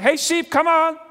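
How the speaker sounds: loud, high casual call to the flock. Pretend. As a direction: holler at the sheep carelessly. Shepherd calling the flock.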